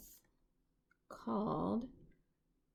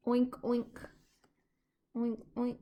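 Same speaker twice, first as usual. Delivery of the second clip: higher, grunting oinks in a piggy voice; pig oinking; 5 semitones higher, 3 dB louder than usual. A narrator's reading. Reading to little ones, oinking like the pig, higher and piggy. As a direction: oink it like a playful pig. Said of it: "and they say".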